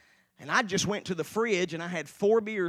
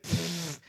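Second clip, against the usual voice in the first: dismissive puff of air; scoffing pfft. Retold or imitated. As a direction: imitate a friend's scoff. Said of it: "He went".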